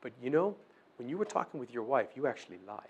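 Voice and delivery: the accuser slipping in a soft, low charge; sly accuser. Pretend. Voices the accuser, insinuating.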